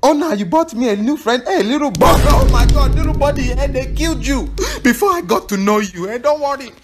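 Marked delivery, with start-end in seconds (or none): none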